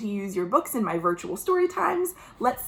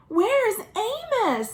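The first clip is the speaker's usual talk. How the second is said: much higher, slow, wondering; worried animals; 11 semitones higher, slower than usual. Acted. Zoo animals missing their friend, slow worried wondering. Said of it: "the animals wondered".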